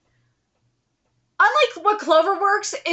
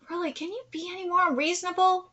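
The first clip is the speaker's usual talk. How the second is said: usual speaking voice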